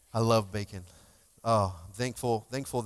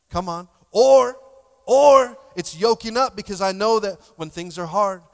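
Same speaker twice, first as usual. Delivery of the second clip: much higher and louder, full-force preaching; preaching shout; 9 semitones higher, 14 dB louder than usual. Real, not acted. Pastor firing up the congregation, shouting. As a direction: belt it out to the room.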